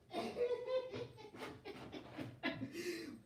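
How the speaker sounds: soft, almost crying laugh; laughing till it turns to tears; tearful laugh. Real, not acted. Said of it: "Y'all got me cracking up here".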